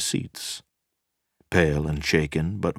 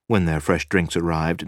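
ordinary talk

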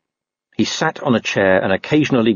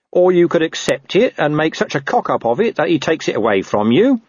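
higher-pitched corporal making a suggestion; lightly voiced scheming Nobby; 8 semitones higher than usual. Corporal advising worried sergeant, lightly voiced and helpful. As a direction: offer a dodge, lightly in character.

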